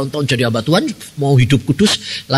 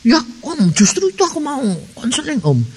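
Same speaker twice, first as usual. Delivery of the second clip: put-on high, light young woman's voice; girlish voice; 12 semitones higher than usual. Young woman asking the pastor for counseling, high voiced.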